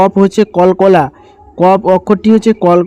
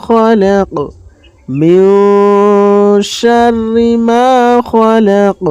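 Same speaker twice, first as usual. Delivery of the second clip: slow, higher melodic tajweed chant; Quran recitation; 3 semitones higher, much slower than usual. Shown as a demonstration. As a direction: recite it slowly, stretching the vowels. Teacher recites verse lines. (ধীর সুরেলা তিলাওয়াত)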